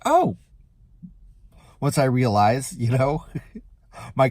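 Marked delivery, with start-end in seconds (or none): 0.1-0.4 high surprised oh. Retold or imitated. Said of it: "And I'm like".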